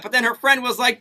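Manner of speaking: ordinary talk, nothing marked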